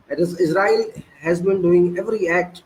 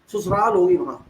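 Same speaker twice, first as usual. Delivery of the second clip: ordinary talk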